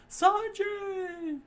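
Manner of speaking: very high, loud cry of his name; scream. A narrator's reading. Crew sees Sanji hit, screams his name high and loud. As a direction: shriek his name in alarm. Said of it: "screamed out his name".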